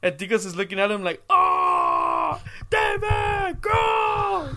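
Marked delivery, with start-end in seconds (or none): 1.3-4.5 very high squealed whoop, imitating a kid; mock excited cheer. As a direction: cheer on a dancing cousin. Retold boy cheering dancing cousin.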